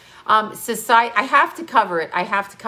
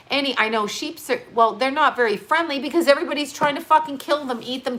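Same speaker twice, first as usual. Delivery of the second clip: ordinary talk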